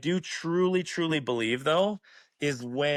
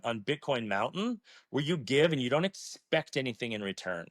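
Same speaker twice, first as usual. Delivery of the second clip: usual speaking voice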